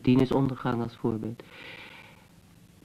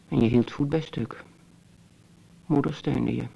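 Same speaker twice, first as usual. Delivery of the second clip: ordinary talk, nothing marked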